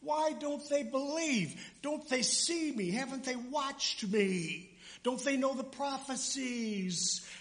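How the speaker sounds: a grieving Jesus sighing over the crowd. Acted. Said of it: "He was".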